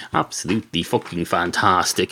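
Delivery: ordinary talk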